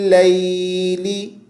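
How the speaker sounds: लैली stretched very slow; correct recitation. Shown as a demonstration. Teacher showing viewers the correct लैली. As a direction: draw लैली out on a long ई. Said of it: "तो क्या पढ़ना है".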